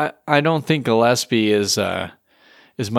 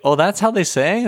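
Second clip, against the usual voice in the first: higher-pitched, mildly incredulous question; mock surprise; 8 semitones higher than usual. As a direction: play a listener taken aback. Acted reaction to old singing.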